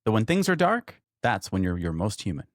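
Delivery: high, loud, bright-eyed show host welcome; big announcer intro. Acted. Host welcoming listeners to the podcast, booming announcer voice. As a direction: open the show like a hyped announcer.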